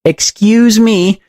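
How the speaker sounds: high, drawn-out call for attention. A narrator's reading. Woman blocked by beds, calling out. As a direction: call out slowly over an obstacle. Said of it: "a heavy-set woman called out".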